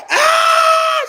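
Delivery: high, loud mock scream; long high-pitched "aah" scream, imitated. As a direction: shriek it out long, acting her reaction. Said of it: "Tisha screamed. She said".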